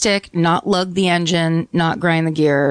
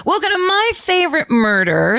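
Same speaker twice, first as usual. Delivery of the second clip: high, bright host welcome; show intro; 9 semitones higher than usual. Acted.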